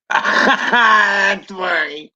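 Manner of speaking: high, laughing outburst. Real, not acted. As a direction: crack up while saying it. Laughing at a chat joke.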